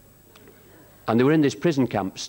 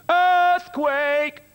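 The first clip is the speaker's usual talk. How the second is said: high, loud alarmed shout; 4 dB louder than usual. Retold or imitated. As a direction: yell it to scatter the squad. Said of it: "Cuthbertson shouts".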